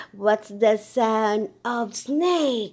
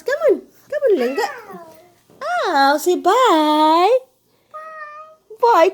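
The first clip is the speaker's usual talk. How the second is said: loud coaxing; 7 semitones higher, 6 dB louder, faster than usual. Real, not acted. Coaxing baby to wave bye.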